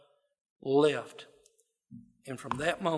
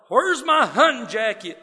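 loud, higher hollered question; mock hollering; 8 semitones higher, 11 dB louder than usual. Husband yelling for his jacket from the yard, hammed-up holler. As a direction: bellow it like a grumpy husband hunting his coat. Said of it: "Daddy keeps on hollering".

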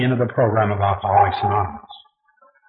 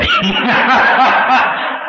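high burst of laughter; 11 semitones higher, 4 dB louder than usual. Real, not acted. Laughs at his own despair.

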